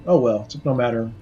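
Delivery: usual speaking voice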